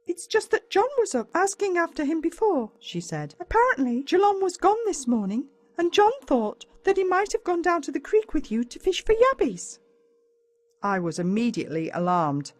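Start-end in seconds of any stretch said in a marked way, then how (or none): 0.1-2.7 higher motherly voice; Mum's voice. A narrator's reading. Mum telling her son about the neighbour, higher. 3.4-9.8 higher motherly voice, mildly worried; a mum passing on news, pitched up; Mum's voice. A narrator's reading. Mum telling her son the missing boy may be with him, higher.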